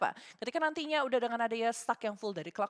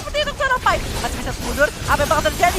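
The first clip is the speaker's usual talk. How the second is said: esports caster; 2 semitones higher, 7 dB louder than usual. Real, not acted. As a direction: drive the teamfight call loudly. Caster hyping teamfight.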